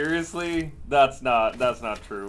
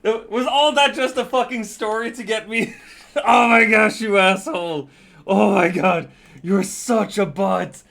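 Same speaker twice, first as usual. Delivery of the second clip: laughing hard, louder and higher; laughing in disbelief; 7 semitones higher, 10 dB louder than usual. Real, not acted. Laughing at a friend who pranked him, laughing. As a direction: crack up at being fooled.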